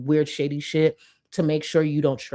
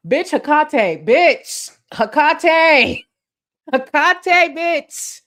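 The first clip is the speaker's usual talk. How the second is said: high, loud excited calling out of a name; thrilled shout to a chat regular; 10 semitones higher, 8 dB louder, much slower than usual. Real, not acted. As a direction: yell the name with delight. Spots Hecate in the chat.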